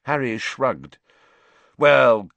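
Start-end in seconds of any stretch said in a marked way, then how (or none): 1.8-2.3 loud, fast caretaker snapping orders; snapped. Acted. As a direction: bark him back to his common room. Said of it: "snapped Filch".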